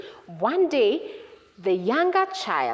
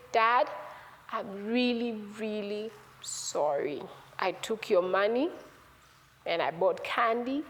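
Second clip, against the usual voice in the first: slow, low, halting confession; downcast, remorseful son; contrite apology; 3 semitones lower, 4 dB quieter than usual. Acted. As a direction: confess it softly, pausing between phrases.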